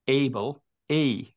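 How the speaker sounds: এবল said slowly, then এই as the ay of able; a teacher separating এই from এবল, slowly; vowel sound demo. Shown as a demonstration. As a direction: draw out এবল and lift out its এই. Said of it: "একটার উচ্চারণ করলাম অ্যাপল".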